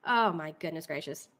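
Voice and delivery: ordinary delivery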